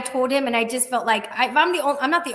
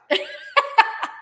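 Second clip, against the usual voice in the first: high burst of laughter. Real, not acted. [name] laughing telling of the free leads.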